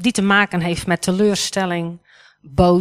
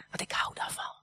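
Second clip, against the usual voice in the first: soft unvoiced whisper; a little secret shared under the breath; whispered aside; 18 dB quieter than usual. Real, not acted. To the congregation, confessing she loves activities, whispered. Playful aside about doing things.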